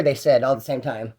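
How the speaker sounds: ordinary talk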